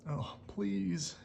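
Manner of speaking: put-on a touch of a pleading old wolf. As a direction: beg for the house. Wolf begs rabbit for house.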